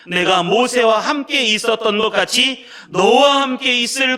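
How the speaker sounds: 느리고 크게, 배에 힘을 준 낭독; 한 글자씩 선포하듯 힘 있게 읽으세요. Acted. 성도들과 힘차게 함께 낭독. (성도들과 함께 여호수아서를 소리 높여 낭독)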